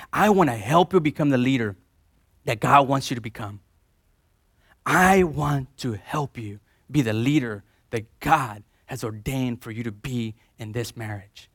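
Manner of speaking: tender wife's promise. Pretend. Wife's voiced vow.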